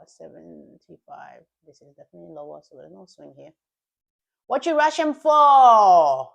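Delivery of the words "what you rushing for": drawn-out, loud and high; slow loud taunt. Acted. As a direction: stretch out the taunt loudly.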